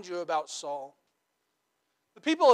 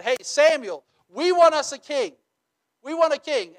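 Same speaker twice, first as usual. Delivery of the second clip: demanding people; 7 semitones higher, 6 dB louder than usual. Retold or imitated. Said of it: "they came to the prophet Samuel and they said".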